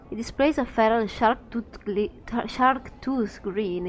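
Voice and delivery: ordinary delivery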